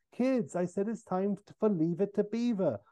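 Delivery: high, lightly voiced calling mum; a hint of a mother summoning children. Mother to kids on the front lawn, calling them in.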